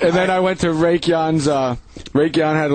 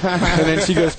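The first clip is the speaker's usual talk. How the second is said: ordinary talk, nothing marked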